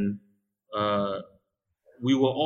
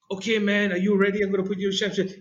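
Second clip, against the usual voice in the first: higher, playful taunt; retelling a challenge to a kid, higher mock voice; mock macho challenge; 8 semitones higher, much faster than usual. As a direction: taunt the kid before the hold. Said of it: "I'm just like".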